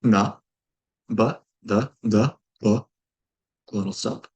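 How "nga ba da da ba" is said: each syllable said crisply on its own, nga, ba, da; phoneme demo. Shown as a demonstration. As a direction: clear sound-by-sound demonstration. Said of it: "eerily familiarly to Hangul".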